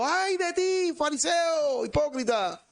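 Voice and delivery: high, fierce prophetic shout; Jesus rebuking. Retold or imitated. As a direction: thunder at the hypocrite. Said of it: "Imagínense Jesús diciendo". (Jesús reprendiendo a gritos, muy agudo)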